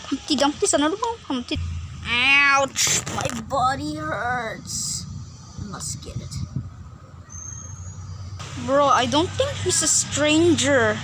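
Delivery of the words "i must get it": whisper. Acted. Sneaky character plots.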